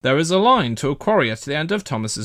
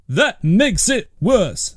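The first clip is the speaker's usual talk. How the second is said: slow stern; 7 semitones higher, 5 dB louder, much slower than usual. A narrator's reading. Policeman retorts.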